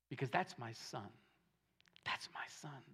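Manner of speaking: hushed, breaking into a whisper; soft whispered plea for his son; whispered tenderness. Acted. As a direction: let a father's love drop to a whisper. David's tender plea for Absalom.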